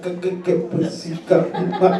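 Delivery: soft, low, halting stammer; mock stutter. Comedian imitates a stutterer stuck on stage, mock stuttering. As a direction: stumble and stick on the syllables, quietly. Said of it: "if you stutter".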